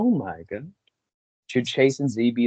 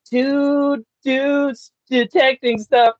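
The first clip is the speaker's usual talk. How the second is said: excited; 12 semitones higher, 4 dB louder, much slower than usual. Real, not acted.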